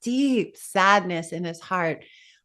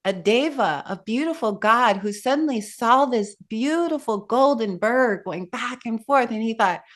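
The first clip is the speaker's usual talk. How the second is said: usual speaking voice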